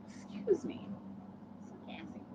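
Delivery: hushed whispered apology; a quick embarrassed whisper aside. Real, not acted. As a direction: whisper it so nobody hears. Excusing herself after burping.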